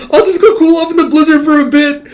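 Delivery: high, loud, fast embarrassed squeal; Yor flustered. Acted. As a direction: blurt it out to escape the room. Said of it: "getting blushing and all flustered".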